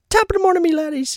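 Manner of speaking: imitating Jacksepticeye's loud, high Irish greeting; Irish intro impression. Retelling Jacksepticeye's old intro in a loud high Irish accent. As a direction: shout it like an Irish YouTube intro. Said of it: "he'd, like, smack the camera, be like".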